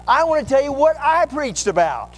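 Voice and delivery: rising to a loud, high, bragging rant; mock boastful retort. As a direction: shout the preacher down to brag about yourself. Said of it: "And I'll say".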